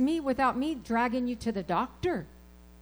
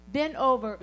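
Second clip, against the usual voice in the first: ordinary delivery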